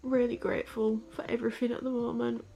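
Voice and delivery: grateful, voice wobbling with tears; teary. Real, not acted. Telling viewers she's grateful, teary and soft. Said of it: "not gonna bore you with my crying".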